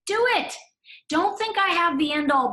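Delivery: high, punchy encouragement; emphatic. Real, not acted. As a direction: say it like a bold go-ahead. Urging listeners to choose.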